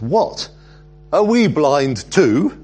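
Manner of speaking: high, loud incredulous question; indignant disbelief. Acted. Pharisees challenging Jesus over what he said, indignant disbelief. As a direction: voice the affronted challenge, stretching it out slowly.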